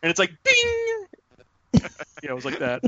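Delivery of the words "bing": mock bing. Crotch punch sound effect.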